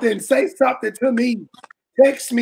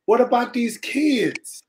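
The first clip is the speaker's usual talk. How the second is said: normal talking voice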